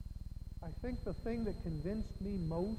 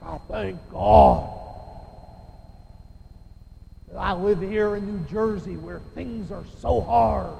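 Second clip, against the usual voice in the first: Ron; 15 dB louder than usual. Retold or imitated. Preacher imitating a friend praying in the office, booming voice. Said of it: "start praying in that deep voice of his".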